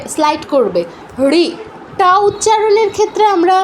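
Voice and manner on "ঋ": রি short, tongue sliding back from the ridge; retroflex short ri. Shown as a demonstration. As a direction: slide the tongue back for a quick রি. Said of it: "মাড়ি থেকে একটু পেছনের অংশে". (মূর্ধা থেকে ছোট রি)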